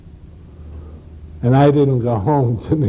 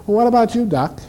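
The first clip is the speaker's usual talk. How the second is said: a sassy, teasing nurse, pitched higher; smart aleck nurse; 10 semitones higher than usual. Retold or imitated. Nurse teasing the doctor on the phone, cheeky and higher. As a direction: needle the doctor with a smirk. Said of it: "the smart aleck nurse said".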